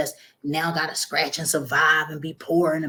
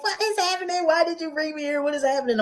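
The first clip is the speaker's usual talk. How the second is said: high, panicked, near tears; hysterical scream; 11 semitones higher than usual. Retold or imitated. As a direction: scream it out, lost and terrified. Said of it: "I just started screaming".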